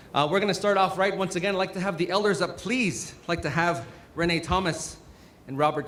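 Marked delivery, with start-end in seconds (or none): none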